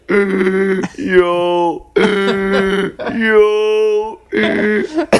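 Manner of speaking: high rising wheezing laugh; Jeff's wheezy laugh. Retold or imitated. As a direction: wheeze out a smoker's laugh, climbing up. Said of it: "this is how he laughed".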